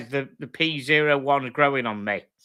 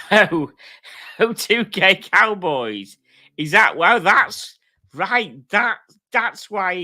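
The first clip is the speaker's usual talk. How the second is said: surprised realisation; 7 semitones higher, 7 dB louder, slower than usual. Real, not acted. Reacting to chat comment.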